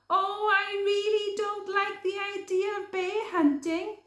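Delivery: high, sing-song wailing rabbit; fretful cry. Acted. Podgy crying to Hare about bear hunting, high wail. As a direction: whine about the hunt. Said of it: "cried Podgy Rabbit".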